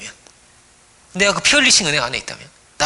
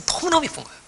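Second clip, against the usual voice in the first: much higher, soft gush on 너무너무; 10 semitones higher, 5 dB quieter than usual. Real, not acted. Preacher gushing over how adorable God finds us. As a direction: squeal 너무너무 with delight. Praising God's love.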